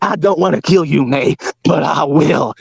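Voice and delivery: drawn-out mocking threat; slowly, mock menacing; slow mock quote of Ralph. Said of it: "That's what you said".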